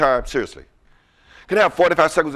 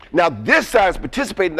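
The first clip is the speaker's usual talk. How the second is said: ordinary delivery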